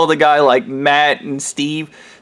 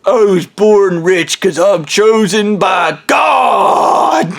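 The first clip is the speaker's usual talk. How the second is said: pompous proclamation; 3 semitones higher, much slower than usual. Retold or imitated. Ham Man boasting to Matt about his wealth. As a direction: announce it like divine right. Ham Man's smug answer.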